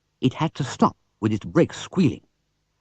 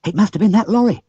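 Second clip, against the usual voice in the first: alarmed; 11 semitones higher, 7 dB louder, faster than usual. Acted. Bill alarmed after theft.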